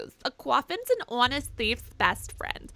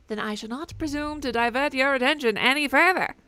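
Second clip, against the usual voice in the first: ordinary talk, nothing marked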